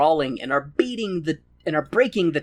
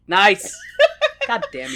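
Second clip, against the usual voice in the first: normal talking voice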